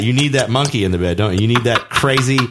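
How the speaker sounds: ordinary delivery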